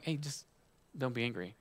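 hushed, placating imitation; mock calming. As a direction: softly play someone trying to soothe an upset person. Mimics someone calming an angry person.

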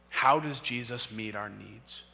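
ordinary delivery

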